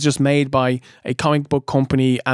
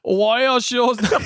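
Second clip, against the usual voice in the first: put-on failed Arnie accent; 6 semitones higher than usual. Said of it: "One more, one more".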